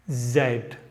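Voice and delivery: ordinary delivery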